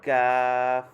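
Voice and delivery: "কাফ" flat, loud and drawn out; flat sound. Shown as a demonstration. Teacher repeating the letter for students, flat "কাফ". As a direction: sustain a flat open vowel in "কাফ". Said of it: "flat sound".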